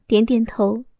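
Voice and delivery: usual speaking voice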